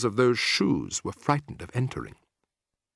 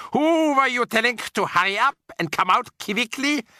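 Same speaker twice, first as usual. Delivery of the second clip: witch snapping; 12 semitones higher, 4 dB louder than usual. A narrator's reading. Grand High Witch demanding who calls, sharp witch voice. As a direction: snap it out like a haughty witch.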